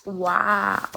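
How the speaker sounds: ordinary talk, nothing marked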